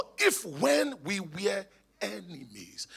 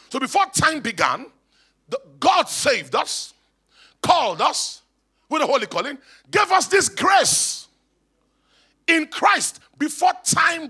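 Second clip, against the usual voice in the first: loud, emphatic preacher, pitched up; full-force preaching; 7 semitones higher, 9 dB louder than usual. Real, not acted. Preacher telling church redemption was never plan B, full-force preaching. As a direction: drive each phrase home to the congregation.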